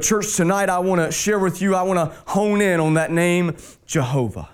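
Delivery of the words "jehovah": reverent low. Acted. Honing in on the name.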